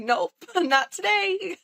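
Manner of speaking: high, slow, laughing nope; laughing refusal. Retold or imitated. Telling her aunt no through laughter, high and slow. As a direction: laugh it off but mean it. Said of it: "I'd had enough. I was like".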